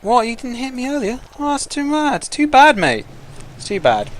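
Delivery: high, squeaky mock sympathy; lightly voiced gloating skink. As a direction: tease him like a smug little lizard. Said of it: "this skinky's like".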